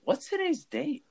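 high-pitched mock question of the old lady; imitating old cashier. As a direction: pipe it up like a fussy old cashier. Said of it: "And then she was like".

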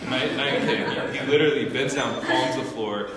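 high, loud laughing retelling; laughing, excited. Real, not acted. Laughing, telling friends how he palmed the floor. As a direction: laugh through the amazed telling.

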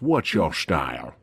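low, slow, deadpan movie line; movie villain quote. Retold or imitated. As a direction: drop low and slow like the film. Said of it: "when the guy goes".

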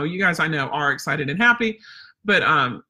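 normal talking voice

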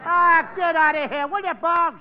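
loud, high brush-off; telling Bugs to get lost, loudly; fed-up shout. Acted. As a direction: shoo the prankster off the line. Shooing off a prank caller.

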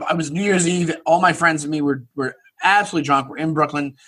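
ordinary talk

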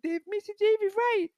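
put-on very high squeaky little kid voice. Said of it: "I didn't sound like that".

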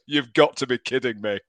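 put-on exasperated fan in Yorkshire accent. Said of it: "in a very thick Yorkshire accent".

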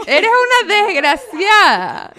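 very slow, loud drawn-out insult; mock insult. Daughter pretending to call her mother a wretch, slow and loud. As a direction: lean hard on every syllable, mock outrage. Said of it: "Casi le digo desgraciada".

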